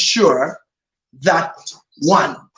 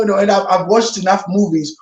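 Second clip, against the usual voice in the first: ordinary talk, nothing marked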